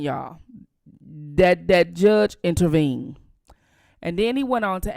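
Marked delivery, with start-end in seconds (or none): 1.0-3.2 loud, insistent stress on JUDGE; emphatic louder. Real, not acted. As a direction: drive it home: that judge intervened. Hammering the point home.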